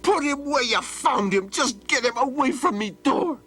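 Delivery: put-on high raging old man's yell. Old man rages at Donald to take the lion away, high and furious. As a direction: bark it in fury. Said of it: "The old man raged".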